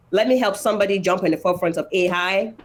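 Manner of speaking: ordinary talk, nothing marked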